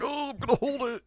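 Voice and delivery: very high, straining cartoon voice; desperate strained whine. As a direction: squeal it as if bursting to pee. Goku desperate to hold pee.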